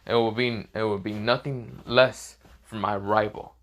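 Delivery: ordinary delivery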